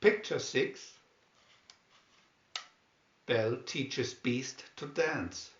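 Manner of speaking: usual speaking voice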